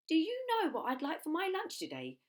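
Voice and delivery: high, loud sly crocodile; greedy croc asking for lunch. Acted. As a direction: purr it like a hungry croc. Enormous crocodile asks greedily.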